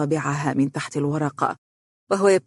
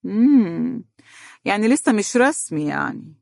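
higher-pitched, affected mock interest; put-on knowing sarcasm, pitched up; sarcastic; 5 semitones higher than usual. A narrator's reading. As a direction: feign surprise with a drawn "mm". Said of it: "رفع حاجبيه في تصنع وقال بسخرية".

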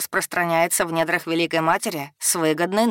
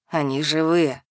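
elf voice with a smile. A narrator's reading. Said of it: "В голосе эльфа послышалась улыбка".

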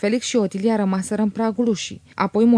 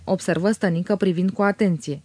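plain reading voice

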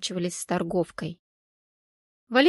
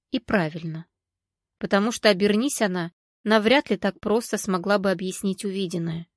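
usual speaking voice

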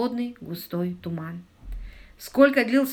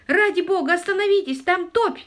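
high-pitched horrified plea; panicked cry held high throughout; terrified shout; 11 semitones higher, 4 dB louder than usual. A narrator's reading. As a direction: scream a desperate warning. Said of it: "в ужасе закричал камердинер".

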